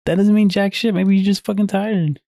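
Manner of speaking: high, fed-up snap at teammates; exasperated retort. Retold or imitated. Telling lazy teammates off at practice, exasperated high snap. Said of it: "I'm just like".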